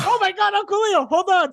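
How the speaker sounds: very high, flustered rush; panicked fluster. Retold or imitated. Host panicking over Uncle Leo's burned lap, very high and flustered. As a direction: scramble to fix the mess. Rushing to help Uncle Leo.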